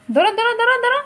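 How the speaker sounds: high, loud excited urging. Real, not acted.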